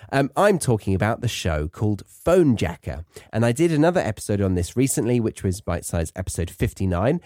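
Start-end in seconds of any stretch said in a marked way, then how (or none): none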